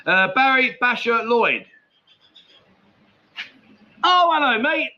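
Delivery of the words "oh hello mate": excited greeting. Real, not acted. Spots friend's name in chat.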